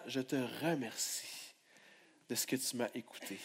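low, hushed thank-you; soft prayer. Acted. Preacher voices Jesus' prayer to the Father, hushed. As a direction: breathe it like a prayer.

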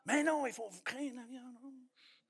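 a hint of a nagging scold, thin and quiet; mock fearful voice. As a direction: mimic a fussy warning voice, mocking. Mocks the voice of fear.

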